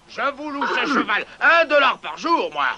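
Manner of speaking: ordinary delivery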